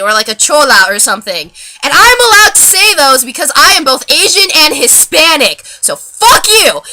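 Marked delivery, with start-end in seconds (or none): 1.8-6.8 loud, high-pitched angry retort; defiant outburst. Real, not acted. Vlogger answering imagined critics, loudly defiant. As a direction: fire back at anyone who objects.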